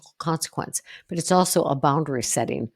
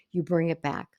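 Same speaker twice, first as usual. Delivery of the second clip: normal talking voice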